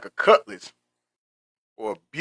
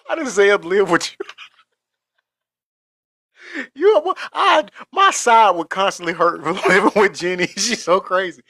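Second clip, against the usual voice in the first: laughing; 7 semitones higher than usual. Real, not acted. Still laughing at Jenny.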